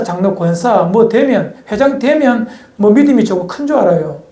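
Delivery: ordinary talk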